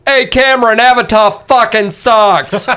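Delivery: loud mocking yell across the river; shouting. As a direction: yell it to get his attention. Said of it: "Tardigrade shouts".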